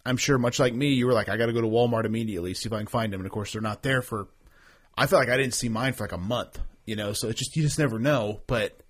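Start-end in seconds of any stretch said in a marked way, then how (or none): 1.3-3.2 hurried, eager inner voice; rushed urgency. Retold or imitated. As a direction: rattle it off in a rush. Retold urgent thought.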